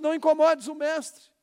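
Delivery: loud, high mocking taunt of the enemy. Preacher voicing the enemy of our dreams, loud mocking taunt. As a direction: sneer it like a voice whispering doubt. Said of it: "o diabo tá soprando no seu ouvido".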